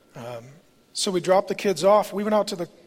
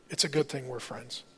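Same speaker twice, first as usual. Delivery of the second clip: soft, low retold comfort; tender reassurance; 5 semitones lower, 7 dB quieter than usual. Wife takes her husband's hand, tender reassurance. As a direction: quote her gently, low and hushed.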